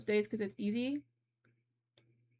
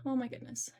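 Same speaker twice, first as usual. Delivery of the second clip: ordinary talk, nothing marked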